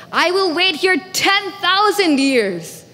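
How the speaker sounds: high, forceful declaration; defiant vow. Acted. Shiva vowing to outwait a stubborn mother, defiant. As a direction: raise the pitch and declare it defiantly.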